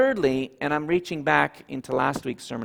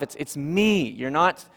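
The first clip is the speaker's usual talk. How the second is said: ordinary delivery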